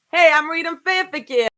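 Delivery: hammed-up New York accent. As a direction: bark it like a New Yorker, half in jest. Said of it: "I don't have a good New York accent".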